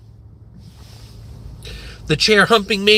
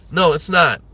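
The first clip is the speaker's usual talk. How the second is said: ordinary delivery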